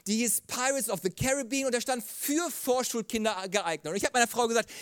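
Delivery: ordinary delivery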